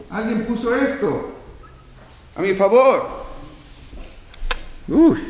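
high-pitched mock amazement; acted astonishment. Preacher plays someone finding money in the bank account, astonished. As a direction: act out stumbling on an unexpected windfall.

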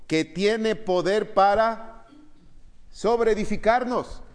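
drawn-out, high and loud verse reading; slow emphatic reading. Acted. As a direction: stretch out each word of the verse. Reads the verse again. (lectura lenta y enfática)

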